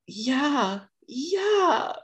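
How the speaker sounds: high giddy agreement; giddy yeah. Retold or imitated. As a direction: squeal it like an excited boy. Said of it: "he's like".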